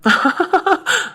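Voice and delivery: high, light burst of laughter; pleased laugh. Acted. Boyfriend hears she likes him now, pleased laughing. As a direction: laugh happily in a quick run.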